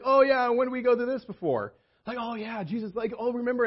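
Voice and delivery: high, loud, eager recall; light up as if you just remembered the miracle; excited remembering. Retold or imitated. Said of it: "And they'd be all excited".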